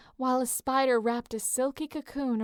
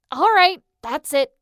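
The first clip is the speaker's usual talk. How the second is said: loud, higher, fed-up outburst; loud decision; 5 semitones higher, 11 dB louder than usual. A narrator's reading. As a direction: put your foot down. Leo fed up, taking action.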